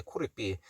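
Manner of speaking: "could it be" easy, with light R-like flaps; natural, relaxed. Shown as a demonstration. Teacher modelling a natural "could it be", relaxed and lightly flapped. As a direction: say "could it be" casually, without curling hard. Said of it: "그냥 뭐 자연스럽게 편하게".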